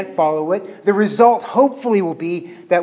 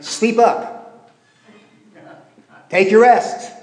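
higher, mock-gentle sarcastic jab; mild sarcasm; 5 semitones higher, 4 dB louder, slower than usual. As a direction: tease them lightly, higher. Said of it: "It's a little sarcasm".

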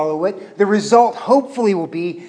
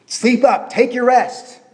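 higher, mocking rebuke; mild sarcasm; 7 semitones higher, 3 dB louder than usual. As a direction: needle them, a touch higher. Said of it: "It's a little sarcasm".

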